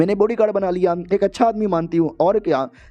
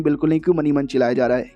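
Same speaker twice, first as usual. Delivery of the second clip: ordinary delivery, nothing marked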